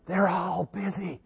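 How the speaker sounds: put-on high breathy whisper of a small child. Little Jimmy whispering his name to the caller, high and hushed. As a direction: whisper your name like a hiding kid. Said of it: "And little Jimmy keeps whispering".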